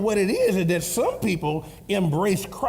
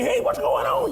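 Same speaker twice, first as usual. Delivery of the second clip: excited greeting. Retold or imitated. Grandpa acting excited greeting.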